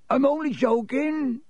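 high, amused chuckle; laughing. A narrator's reading. Hagrid laughing at Harry, joking. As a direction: tease him with a laugh. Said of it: "Hagrid was laughing".

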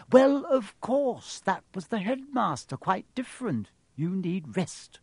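strict nurse. A narrator's reading. Nurse refuses Harry a visitor, strict and fussy. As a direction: brush off the argument firmly, starting high.

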